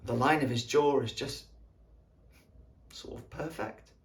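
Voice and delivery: low, soft, halting reminiscence; tender longing. Acted. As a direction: linger softly over each detail, with pauses. Recalling longing for sleeping friend.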